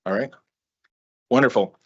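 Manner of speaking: ordinary talk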